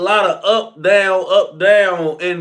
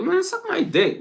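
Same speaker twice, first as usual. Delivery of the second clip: a much higher, squeaky mock female snap; mock aggressive woman; 13 semitones higher, 4 dB quieter than usual. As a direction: parody her shrill insult, pitched way up. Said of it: "They aggressive as hell".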